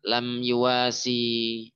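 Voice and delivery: very slow, drawn-out Arabic phrase; very slow recitation. Shown as a demonstration. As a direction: stretch each syllable for them to follow. (dibaca sangat lambat untuk ditirukan)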